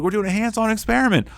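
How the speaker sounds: upbeat teacher. Retold or imitated. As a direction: rush to excite a child. Teacher cheering up upset girl.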